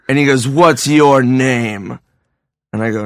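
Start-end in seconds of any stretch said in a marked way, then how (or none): 0.5-2.0 drawn-out, commanding question; slow menacing ask. Retold or imitated. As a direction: stretch it out like a creepy bar king. Old man on throne speaks.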